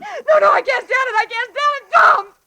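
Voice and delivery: high, loud rising hysteria; hysterical. Acted. Woman realizing the stranger is Tom, hysterical with fear. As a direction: come apart in terror.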